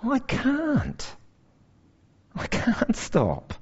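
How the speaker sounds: slow, soft, helpless confession; preacher voicing his own helpless reply; helpless admission. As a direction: confess it quietly, defeated. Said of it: "my response is".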